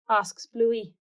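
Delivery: ordinary delivery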